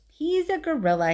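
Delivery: bright helpful interjection; perky dog. A narrator's reading.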